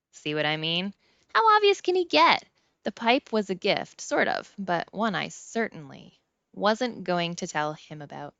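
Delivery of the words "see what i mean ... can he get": high, loud disbelief; how obvious, scoffing loudly; exasperated. A narrator's reading. Narrator exasperated at doctor.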